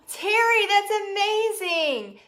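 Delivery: gasp, then high, drawn-out delight; excited praise. Real, not acted. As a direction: gush with amazement at good news. Praising a viewer's reported progress.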